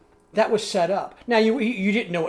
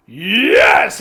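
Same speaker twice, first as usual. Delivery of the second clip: sudden much louder, wild shout of agreement; crazed yes; 5 semitones higher, 13 dB louder than usual. Acted. King agreeing to ride out with Aragorn, wild loud shout. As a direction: explode with a mad yes.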